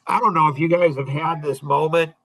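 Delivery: ordinary talk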